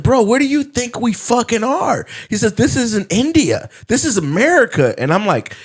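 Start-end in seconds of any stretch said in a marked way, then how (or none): 0.0-2.1 high-pitched mock exasperation; retold incredulous scolding; going off. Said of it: "he's just going off on him". 2.5-4.9 high-pitched mock indignation; retold exasperated lecture; going off. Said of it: "he's just going off on him".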